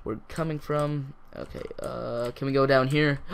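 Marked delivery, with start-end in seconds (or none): none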